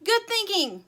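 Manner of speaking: high, loud shouted approval; yelling. A narrator's reading. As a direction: yell back your agreement. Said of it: "yelled Sugar".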